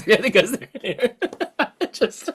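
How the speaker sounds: high giggly voice breaking into laughter; laughing while explaining. Real, not acted. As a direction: talk through real laughter. Cracking up over the term.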